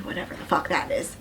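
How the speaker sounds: dry whispered aside; sarcastic whisper. Acted. To the viewers about the date, a sarcastic whisper. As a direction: whisper it like rolling your eyes.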